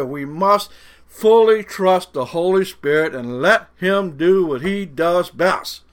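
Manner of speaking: ordinary delivery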